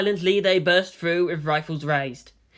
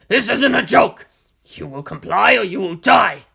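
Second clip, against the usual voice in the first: louder furious soldier; loud threat; 3 semitones higher, 8 dB louder than usual. Acted. Delta One threatens Misato at gunpoint, loud. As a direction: force her to comply.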